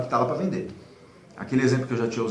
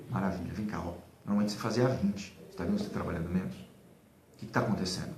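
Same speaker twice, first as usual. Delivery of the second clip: lower, softer voice, as if taking the seller aside; low-key managerial probing; 6 semitones lower, 7 dB quieter than usual. Acted. Manager to a seller whose calls have dropped, quiet and probing. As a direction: play a manager quietly checking in.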